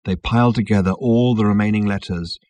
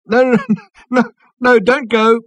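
high, loud, breathless calling out; gasped, high-pitched plea; calling between gasps; 13 semitones higher, 8 dB louder than usual. A narrator's reading. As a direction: call after them urgently while gasping with laughter. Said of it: "called Ford Prefect between gasps".

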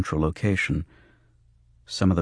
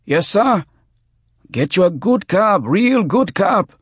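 high, loud, eager-to-please tout; frantically obliging hustler, pitched way up; servile street tout; 14 semitones higher, 7 dB louder than usual. Acted. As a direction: yelp it out, anxious to oblige. Hurt tout keen to please.

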